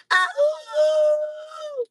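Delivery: high, loud cry that cracks; voice cracking. Real, not acted. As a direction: voice breaking on a shouted call. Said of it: "I'm losing my voice".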